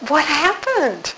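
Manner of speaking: high, soft puzzled What happened; surprised question. Retold or imitated. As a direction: caught off guard, ask gently. Surprised by reply.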